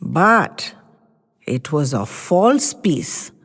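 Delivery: suspenseful narration. A narrator's reading. Slows for suspense.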